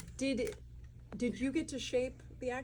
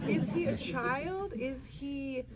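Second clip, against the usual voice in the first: high, very slow incredulous question; mock bafflement; 4 semitones higher, much slower than usual. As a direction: stretch it out, playfully puzzled. Acts out baffled reaction while watching.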